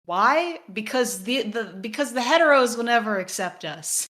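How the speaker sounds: sarcastic loud mock voice; sarcastic mock answer. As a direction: mockingly answer your own question, loud and high.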